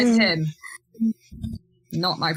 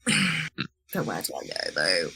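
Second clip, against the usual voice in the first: low, creaky, stressed-out muttering; 12 semitones lower, slower than usual. Real, not acted. Player unsure where to go next, really stressed. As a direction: let the voice sink low under the stress. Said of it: "I'm stressed".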